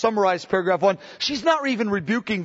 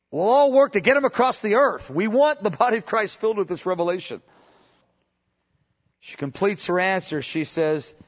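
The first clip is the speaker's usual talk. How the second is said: ordinary delivery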